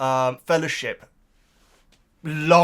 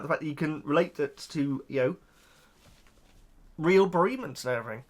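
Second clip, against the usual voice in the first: ordinary talk, nothing marked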